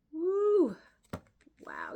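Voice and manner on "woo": a loud, high burst of delight; excited whoop. Real, not acted. Reading cards aloud, she whoops with real delight. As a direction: whoop with sudden joy at the card. Reacting to a powerful card.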